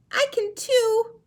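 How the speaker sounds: very high, loud, slow penguin squeak; a little chick insisting it can too. Shown as a demonstration. Teacher voicing Little Penguin, high and slow. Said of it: "show you how to use voices".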